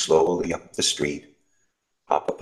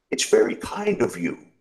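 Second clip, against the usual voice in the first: grateful road sweeper; 6 semitones higher than usual. Acted. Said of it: "he said".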